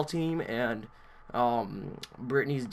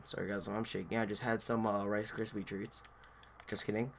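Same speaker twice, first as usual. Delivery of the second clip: low, soft aside to viewers; hushed aside; 5 semitones lower, 13 dB quieter than usual. Real, not acted. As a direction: drop your voice for a throwaway joke. Quiet joking aside to viewers.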